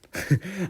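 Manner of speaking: high amused laugh; short laugh. Real, not acted. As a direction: laugh at yourself. Laughs at own mistake.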